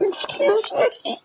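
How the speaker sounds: very high and slow, prissy; squeaky mock outrage. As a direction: stretch it out in a prim falsetto. Mock prim reaction to chewing.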